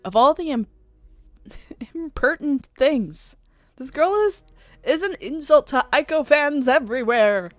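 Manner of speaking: high, loud, affronted outburst; indignant fan. Acted. Offended fan ranting about an insult to Aiko, indignant. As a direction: splutter with offended fan outrage. Character outraged at insult to idol.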